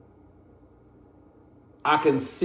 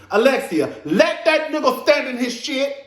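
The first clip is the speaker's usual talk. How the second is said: scolding Alexia; 8 semitones higher, 3 dB louder than usual. Real, not acted. Addressing Alexia through screen.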